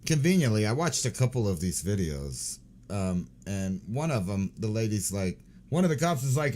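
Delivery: usual speaking voice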